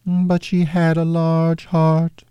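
high, otherworldly spirit voice; ghost voice. Acted. Ghost recalling Scrooge's sister, high spirit voice. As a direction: speak as a gentle, distant ghost, pitched high.

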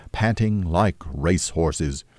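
plain reading voice

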